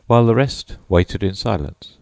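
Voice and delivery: plain reading voice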